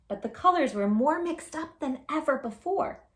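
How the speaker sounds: usual speaking voice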